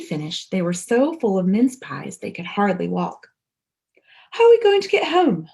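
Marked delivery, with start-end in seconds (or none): 4.3-5.5 worried high question. A narrator's reading. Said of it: "said Paddington".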